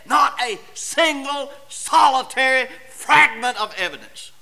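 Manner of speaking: loud, high, driving pulpit voice; preacher in full flight, high and loud; preaching at full force. Acted. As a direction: thunder it from the pulpit, building.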